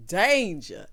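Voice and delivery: ordinary talk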